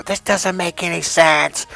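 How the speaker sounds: slow indignant complaint, acted out; angry client. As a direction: imitate an exasperated, pissed-off client. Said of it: "they'd be angry and pissed".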